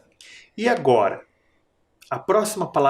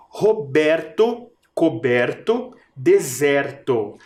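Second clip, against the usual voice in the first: Roberto, coberto, deserto read slowly one by one; slow rhyme list; slower than usual. Shown as a demonstration. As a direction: space out each rhyme word slowly. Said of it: "Pensa na vogal mais forte".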